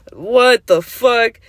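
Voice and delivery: outraged disbelief. Real, not acted. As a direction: stretch every word in outrage. Reacting to ex's audacity.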